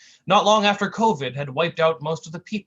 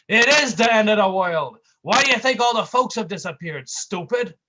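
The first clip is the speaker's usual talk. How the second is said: angry tough guy; 6 semitones higher, 7 dB louder than usual. A narrator's reading. Brick continues angrily.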